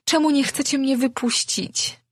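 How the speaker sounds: high, pleading cry; desperate pleading. A narrator's reading. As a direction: beg to be let go. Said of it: "wołała zrozpaczona Imi".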